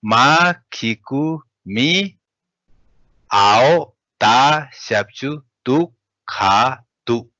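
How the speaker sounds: careful teacher reading; slow Tibetan model reading. Shown as a demonstration. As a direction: read the Tibetan phrase slowly, word by word. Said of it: "let me read".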